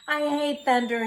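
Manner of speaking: high, loud frightened wail; whimpering. A narrator's reading. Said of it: "he whimpered".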